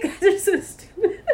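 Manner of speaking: high, giggly exasperation; laughing. Real, not acted. Laughing at bartender's posturing.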